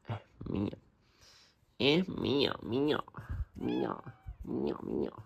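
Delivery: possessive playful mío. Pretend. Guarding ice cream from dog.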